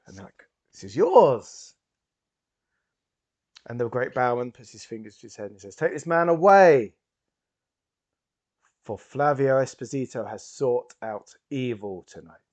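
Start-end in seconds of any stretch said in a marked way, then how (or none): 0.7-1.7 loud, drawn-out, high exclamation; amazed reveal. Acted. Waiters hand over second wallet, loudly amazed. 5.7-12.4 loud, high theatrical order, swelling on the last word; commanding showman. Acted. Stage hypnotist orders the culprit removed, booming command.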